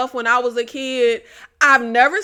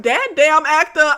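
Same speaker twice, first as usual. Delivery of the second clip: usual speaking voice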